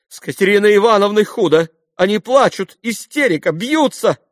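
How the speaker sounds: high, loud, panicky report; alarmed maid. A narrator's reading. Maid reports Katerina's hysterics, high and alarmed. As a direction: burst in crying the bad news.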